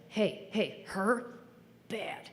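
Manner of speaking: softly confiding, a word at a time; aphasic dad confiding. Retold or imitated. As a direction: imitate dad's hushed, one-word verdict. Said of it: "pulls me aside and is like".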